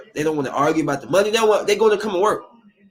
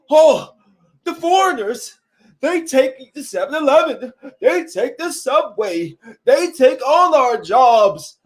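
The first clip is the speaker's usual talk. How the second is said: a put-on voice for a griping complainer, slowing down near the end; mocking complainer; 5 semitones higher, slower than usual. Mocking people who say foreigners take their jobs, in a put-on griping voice. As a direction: lightly ham up the grumbler who blames the foreigners.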